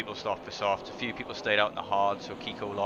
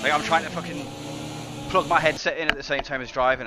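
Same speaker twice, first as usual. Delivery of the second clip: louder, higher exasperated venting; frustrated; 6 semitones higher, 6 dB louder than usual. Real, not acted. Racer explaining the headset trouble behind the crash, frustrated. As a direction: raise your voice in real exasperation. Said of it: "I'm so fucking frustrated with this".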